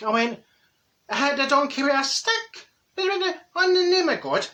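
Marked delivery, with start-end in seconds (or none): 1.1-4.5 high, incredulous outcry; mock horror. [name] hears about hitting the donkey, high mock horror. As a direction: recoil in disbelief, voice shooting up. Aghast reply to friend's suggestion.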